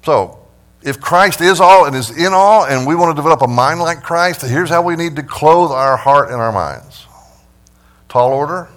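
loud, high preacher's climax; preaching at full force. Acted. Pastor rousing the congregation, preaching loud and high. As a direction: drive the point home to the church.

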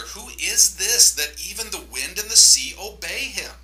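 high, loud, fearful cry; frightened disciples. A narrator's reading. Disciples in the boat cry out in fear. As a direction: cry out in terror at the sea.

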